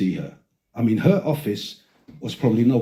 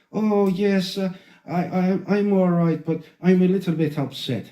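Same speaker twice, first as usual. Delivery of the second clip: higher, put-on Irish accent; hesitant Irish lady, pitched up; Irish lady impression; 6 semitones higher than usual. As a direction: imitate an upset Irish woman. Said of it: "a Jamaican Irish lady".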